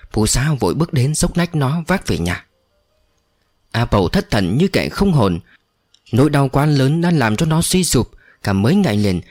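usual speaking voice

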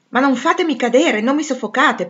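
high, exasperated objection; loud protest. A narrator's reading. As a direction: fend off people clinging to you. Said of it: "protestò egli". (protesta ad alta voce)

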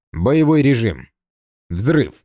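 cold robotic mech voice; cold metallic voice. Acted. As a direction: announce it like a battle machine. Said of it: "холодный металлический голос".

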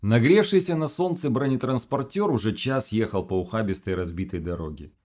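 plain reading voice

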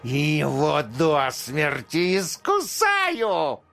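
high, slow hissing rodent voice; hissing mouse king. A narrator's reading. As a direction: hiss it like a menacing mouse king. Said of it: "прошипел на ухо".

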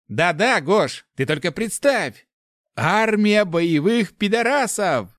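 high, loud, drawn-out enthusiasm; excited sidekick backing the scheme; eager agreement. A narrator's reading. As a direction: chime in with relish. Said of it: "поддакнул Руди".

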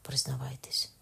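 whispered into the ear. A narrator's reading. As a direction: breathe it secretly. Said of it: "шепнув йому Кабош на вухо".

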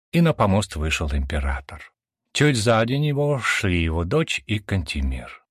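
ordinary delivery, nothing marked

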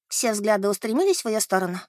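usual speaking voice

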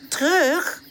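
surprised. Acted. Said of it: "zegt Charlie verbaasd".

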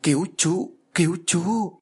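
high, thin, faraway ghostly plea; weak plea. A narrator's reading. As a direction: beg for help in a feeble far-off voice. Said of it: "có tiếng nói yếu ớt".

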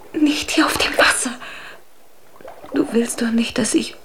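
pleading whisper. Acted. Undine begs Huldbrand in a frightened whisper. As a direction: beg him softly not to curse.

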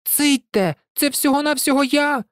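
high-pitched cry, volume only slightly up; shouting. A narrator's reading. Hero calms the panicking hens, a high understated shout. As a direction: cry out high over a racket. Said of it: "щодуху крикнув він".

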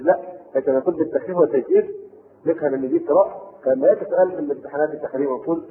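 ordinary talk, nothing marked

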